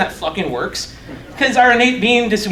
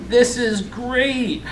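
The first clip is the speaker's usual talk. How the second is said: drawn-out, higher sarcastic remark; 4 semitones higher, 4 dB quieter, much slower than usual. Real, not acted. Speaker to audience as the slides misbehave, sarcastic. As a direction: stretch it out in dry exasperation.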